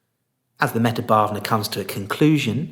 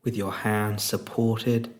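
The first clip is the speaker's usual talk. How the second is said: soft, unhurried meditation voice with long pauses; guided meditation; 4 dB quieter than usual. Real, not acted. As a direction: guide them gently into stillness, leaving space between phrases.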